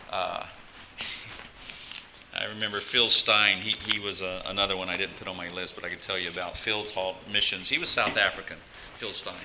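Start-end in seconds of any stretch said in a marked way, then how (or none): none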